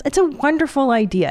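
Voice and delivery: normal talking voice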